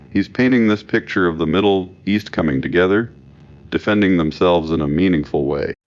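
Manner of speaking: ordinary talk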